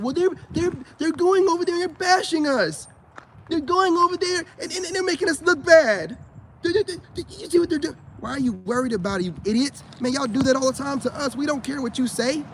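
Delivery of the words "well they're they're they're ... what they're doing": high, whiny mock voice pitched way up; mocking whiny complainers. Mocking women complaining that men abroad bash them, high whiny voice. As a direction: mimic them whining like a wounded victim. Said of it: "So you gotta make it".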